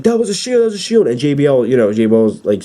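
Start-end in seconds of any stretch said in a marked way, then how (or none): 0.0-1.1 high, excited announcer yelp; Mike Cole excited. Retold or imitated. Commentator insisting the Shield interfered, excited and high. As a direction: pitch way up like a frantic commentator. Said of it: "Mike Cole's like".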